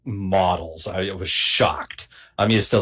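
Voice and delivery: ordinary talk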